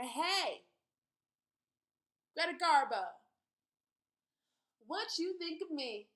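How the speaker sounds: loud, high call up to the screen; yelling up at screen. Acted. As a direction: shout it up at the movie star. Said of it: "She yells up at her".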